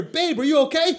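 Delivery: put-on high concerned girlfriend; worried girlfriend voice. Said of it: "I just hear".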